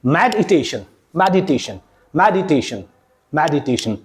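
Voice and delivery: मेडिटेशन as med-i-TAY-shun, low; correct syllable break. Shown as a demonstration. Teacher modelling for students, मेडिटेशन as med-i-tay-shun. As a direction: keep med together in मेडिटेशन, light separate i. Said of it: "break करके देखो".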